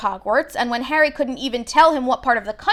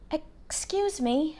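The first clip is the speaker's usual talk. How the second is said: put-on timid boy; 5 semitones higher, 8 dB quieter, much slower than usual. A narrator's reading. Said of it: "Harry said to the plump woman".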